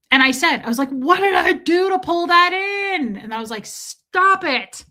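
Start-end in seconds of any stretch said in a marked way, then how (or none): 0.9-3.2 loud, high, drawn-out mock wail; anguished outcry. Mock wail at own hurt elbow. 3.7-4.8 high, loud mock scolding; self-scolding. Snapping at herself in retelling.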